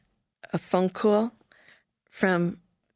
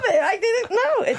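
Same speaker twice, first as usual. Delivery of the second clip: higher, laughing through it; 11 semitones higher than usual. Real, not acted. Laughing to the interviewer about Utah, higher. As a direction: giggle at the irony. Laughing at her own irony.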